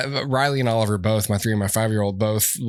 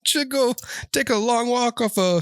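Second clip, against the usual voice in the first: much higher singsong Canadian with "eh"; mock Canadian accent; 11 semitones higher than usual. Hosts mocking polite Canadians, high singsong accent.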